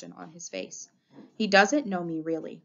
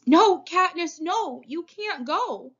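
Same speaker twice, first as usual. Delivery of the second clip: high, loud pleading, last words drawn out; hysterical screaming; 11 semitones higher, 7 dB louder, much slower than usual. A narrator's reading. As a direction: wail it in high, desperate protest. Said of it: "Prim is screaming hysterically behind me".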